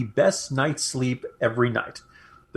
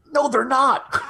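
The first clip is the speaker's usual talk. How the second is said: gleeful; 11 semitones higher, 3 dB louder than usual. Real, not acted. Gleeful jab at Democrats' hopes.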